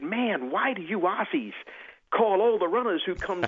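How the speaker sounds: high, drawn-out American accent impression; general american. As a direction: put on a slow American drawl voice. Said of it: "pardon my American accent".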